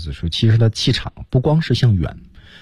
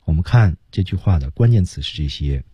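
ordinary talk, nothing marked

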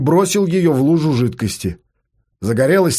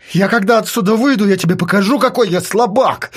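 higher, heated threat; angry, pitched-up warning; threatening promise; 6 semitones higher than usual. Acted. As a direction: vow revenge on the one who locked you in. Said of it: "пообещал он".

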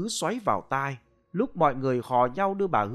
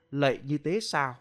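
plain reading voice

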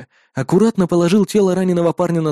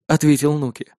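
usual speaking voice

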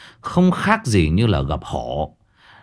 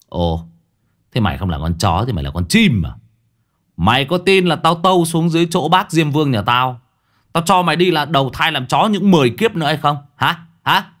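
threatening bully; 6 semitones higher than usual. A narrator's reading. Disciple threatens the ghost.

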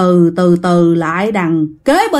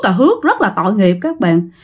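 ordinary delivery, nothing marked

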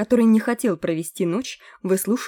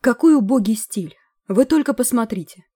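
disdainful; 5 semitones higher, 5 dB louder than usual. A narrator's reading. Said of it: "секретарь правительства скривился".

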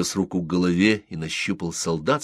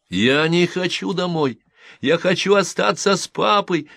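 near tears; 11 semitones higher, 5 dB louder than usual. A narrator's reading. Boy begs to stay with his father, close to tears. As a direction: plead as if about to cry. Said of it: "Джо чуть не плакал".

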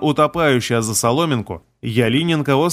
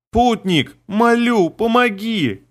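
desperate scream for help; 8 semitones higher, much slower than usual. Acted. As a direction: beg for rescue in panic. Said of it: "Пронзительный женский крик".

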